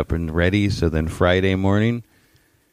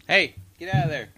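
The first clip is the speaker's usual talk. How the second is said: yelling at dog; 11 semitones higher, 3 dB quieter than usual. Real, not acted. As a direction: shoo the dog away in a high voice. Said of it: "Now you hear me yelling at my dog".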